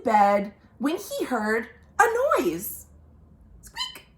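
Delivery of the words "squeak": tiny high squeak, softer; a very high, soft mouse squeak. Acted.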